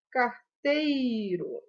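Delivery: "carteiro" very slowly as car-tei-ro; slow teacher's sounding-out of "carteiro"; drawn-out syllables. Shown as a demonstration. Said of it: "O E e o I juntinhos".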